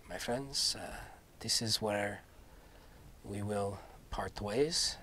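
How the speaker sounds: a lightly voiced noble knight, solemn and soft. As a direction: speak as a grave, courtly knight.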